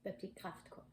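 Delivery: dropped right down, a dry muttered repeat; wry quiet aside. Real, not acted. Booktuber muttering the odd detail back to herself, quiet and wry. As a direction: repeat it quietly to yourself, amused.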